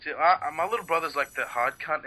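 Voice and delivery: normal talking voice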